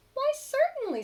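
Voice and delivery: very high, loud cheerful beaver. Acted. Beaver happily agrees to share concrete, very high. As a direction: chirp it out like a kind beaver. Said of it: "said the beaver".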